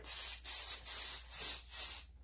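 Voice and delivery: sharp hissed "tss" sounds; repeated voiceless "tss, tss"; monkey chatter. A narrator's reading. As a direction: imitate monkeys with short tongue-tip hisses. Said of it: "shook their fingers back at him and said".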